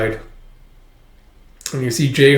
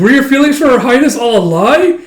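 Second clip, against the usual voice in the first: loud, high-pitched accusing question; outraged accusation; 11 semitones higher, 7 dB louder than usual. Retold or imitated. Companion defending Her Highness's feelings, outraged. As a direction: confront him in acted outrage.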